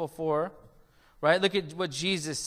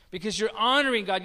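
ordinary delivery

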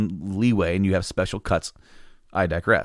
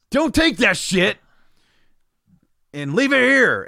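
slow, higher and louder angry scolding; angry yelling; 11 semitones higher, 4 dB louder than usual. Retold or imitated. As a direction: bark it like a grumpy worker defending his stock. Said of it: "It's like".

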